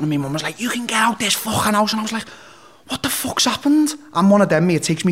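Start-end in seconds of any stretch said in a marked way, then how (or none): 0.6-1.9 high-pitched imitation of a screaming mum; mock yell, pitched up high; retold screaming mum. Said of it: "I woke up to screaming and shouting". 2.9-4.0 high-pitched bewildered question, retold; startled confusion. Retold waking to screaming.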